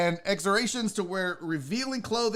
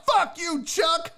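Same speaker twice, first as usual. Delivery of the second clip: shout; 9 semitones higher, much slower than usual. Real, not acted. Ranting vlogger yelling at Chuck Woolery. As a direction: yell it one last time.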